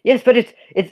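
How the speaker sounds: a hint of an eager councilman; second voice arguing. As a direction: chime in, amused. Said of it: "some yelling".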